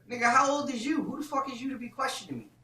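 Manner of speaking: acted outrage at being questioned; indignant. Man answering his questioner back, indignant. Retold quarrel about age.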